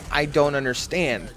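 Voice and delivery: ordinary talk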